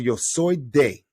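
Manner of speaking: "Yo soy de" said slowly and clearly; slow model for the class. Shown as a demonstration. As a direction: spell it out slowly for repeating.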